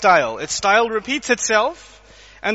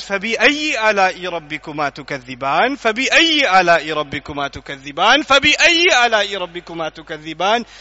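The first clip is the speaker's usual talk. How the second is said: fast, lower recitation of the verse, three times; Quran recitation; 4 semitones lower, much faster than usual. Shown as a demonstration. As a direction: recite the verse swiftly, repeating it.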